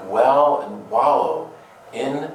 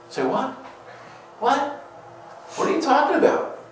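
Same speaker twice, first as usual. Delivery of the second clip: pitched way up, incredulous "say what"; baffled disbelief; faster than usual. Retold or imitated. Congregation reacting to Paul, high baffled disbelief. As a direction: play the stunned listener who can't believe it. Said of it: "We're like".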